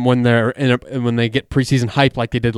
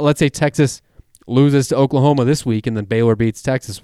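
ordinary delivery

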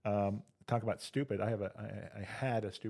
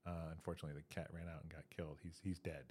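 quiet bad news; 4 semitones lower, 12 dB quieter, faster than usual. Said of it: "And the brother says".

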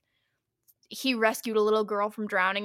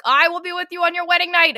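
monster's mock threat; 7 semitones higher, 8 dB louder, faster than usual. Monster threatens Victor about his wedding night, high hammed-up menace. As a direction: intone it like a hammed-up curse.

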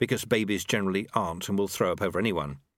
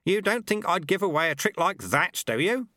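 put-on a higher, sly old monk voice; old sweeper Lutze; 8 semitones higher than usual. A narrator's reading. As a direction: tease him like a cheeky old master.

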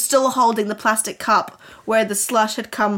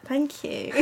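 ordinary talk

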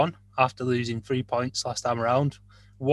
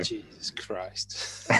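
breathy slow whisper under the breath; 4 semitones lower, 13 dB quieter, much slower than usual. Real, not acted. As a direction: mutter it barely voiced, drawn out. Reacts in disbelief to Graham.

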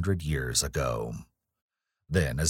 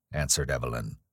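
plain reading voice

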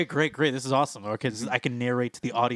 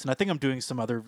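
normal talking voice

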